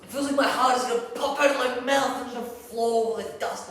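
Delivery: high, loud, mounting distress; panicked rant. Acted. Sleepless person confessing panic attacks to the listener, panicked.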